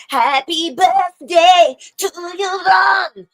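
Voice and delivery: loud, high, drawn-out mock birthday serenade; small Italian singer. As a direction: belt out a crazy birthday greeting, imitating her. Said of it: "she was like".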